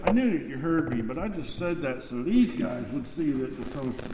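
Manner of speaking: sly aside. Retold or imitated. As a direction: let the crowd not hear this. Jesus's aside after the prayer.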